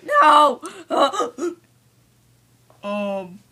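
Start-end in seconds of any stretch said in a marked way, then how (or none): 0.0-0.6 anguished cry. Acted. Acted cry of pain.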